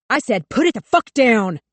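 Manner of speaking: loud, panicked cop barking orders; terrified shout. A narrator's reading. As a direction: yell it with fear and fury. Terrified cop yelling at Huck.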